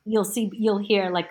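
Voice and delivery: normal talking voice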